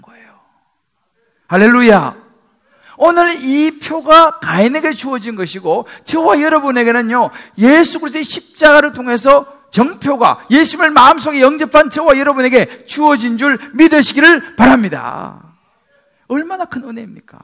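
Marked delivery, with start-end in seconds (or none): 1.5-2.3 ringing hallelujah, louder; preacher's booming hallelujah. Real, not acted. Sermon exclamation. 3.0-15.6 loud, raised preaching voice; fervent pulpit proclamation; full-force preaching. Real, not acted.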